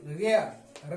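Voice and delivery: रेयर said as "rare", low and falling; model word. Shown as a demonstration. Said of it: "तो इसे क्या पढ़ेंगे?".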